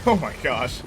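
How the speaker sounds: usual speaking voice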